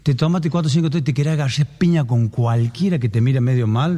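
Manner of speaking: normal talking voice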